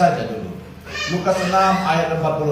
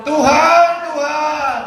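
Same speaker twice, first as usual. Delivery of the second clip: high, loud cry of Tuhan; mock plea; 12 semitones higher, 5 dB louder, much slower than usual. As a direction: call out Tuhan like a pleading worshipper. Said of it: "Mengapa kamu berseru kepadaku".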